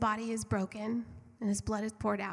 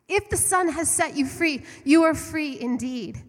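higher and louder, driving the verse home; emphatic repeat; 7 semitones higher, 8 dB louder than usual. Acted. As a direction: preach it again with fire. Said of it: "There are scriptures that say".

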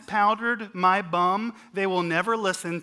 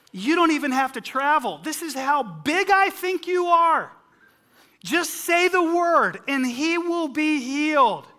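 eager centurion; 6 semitones higher, 7 dB louder than usual. Retold or imitated. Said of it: "And he says to Jesus".

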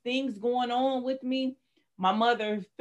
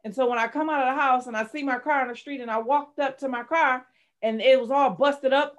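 ordinary talk, nothing marked

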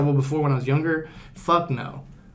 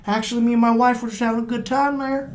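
high, soft, obliging reply to an officer; polite citizen; 7 semitones higher, 3 dB quieter than usual. Acted. Husband answering the helpful cop, polite citizen. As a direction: play the compliant citizen, voice lifted. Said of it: "Be like".

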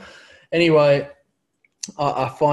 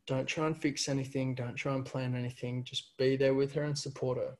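soft, quiet motherly advice; calm her son down; lightly voiced mum, tender; gentle advice; 8 dB quieter than usual. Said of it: "this advice that Mum gave me".

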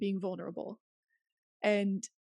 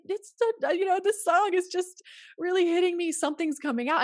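teary reply; 8 semitones higher, 4 dB louder than usual. Retold or imitated. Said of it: "I'm like".

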